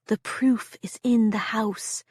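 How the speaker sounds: hushed breathy whisper. A narrator's reading. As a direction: whisper the secret urgently. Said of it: "so quietly".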